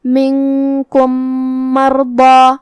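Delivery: slow recitation, منکم merged into مرضی on one long hummed m; ghunnah with shaddah. Shown as a demonstration. Teacher showing students how two meems merge, slow tajweed recitation. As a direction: hold a nasal doubled m joining منکم مرضی, chanted level. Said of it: "غنہ اور تشدید کے ساتھ ادا".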